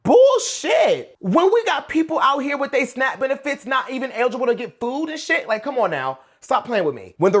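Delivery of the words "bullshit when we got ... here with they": Bullshit yelled high and loud; second Bullshit blasted way up high; outraged shout. Real, not acted. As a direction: shout it out in disbelief. Repeated, far louder and higher.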